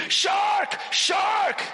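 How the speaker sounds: high panicked scream, way up in pitch; screaming shark. Retold or imitated. Diver screaming to the boat boy in panic. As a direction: yell it in terror, voice cracking high. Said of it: "I'm about to scream".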